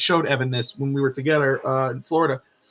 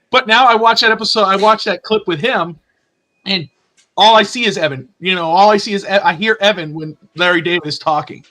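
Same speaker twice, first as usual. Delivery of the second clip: usual speaking voice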